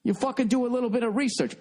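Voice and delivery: usual speaking voice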